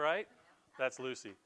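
usual speaking voice

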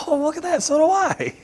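jumps way up high in playful fake amazement; mock surprise. Pastor teasing himself about his hour-long preaching, mock surprised squeal. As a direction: squeal it like a cheeky surprised kid.

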